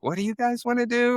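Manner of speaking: high, put-on investor voice; mock puzzled investor. Chef mimicking investors questioning his plan, high mock voice. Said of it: "they'd be like".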